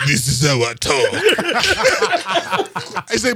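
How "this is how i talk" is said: mimicking a friend's odd deep voice; deep put-on voice, dropped below your own. Friend answering what is wrong with his voice, deep mock voice. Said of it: "what the fuck is wrong with your voice".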